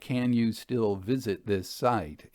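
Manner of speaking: usual speaking voice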